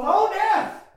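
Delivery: high, loud, mock-triumphant cry at a funeral; mock triumphant mourner. Mourner at a funeral, mock-triumphant, laughing. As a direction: ham up a mourner gloating over death. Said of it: "walks in and goes".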